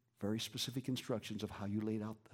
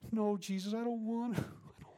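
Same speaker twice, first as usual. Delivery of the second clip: pleading refusal; 9 semitones higher than usual. Retold or imitated. Preacher pleading with Jesus in his vision. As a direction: beg him not to make you.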